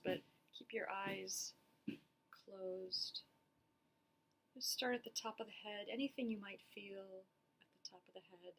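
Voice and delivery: unhurried meditation guidance with long pauses; guided body scan. Acted. Therapist guiding listeners through a body scan, calm and spacious. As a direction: lead them gently through it, leaving space after each phrase.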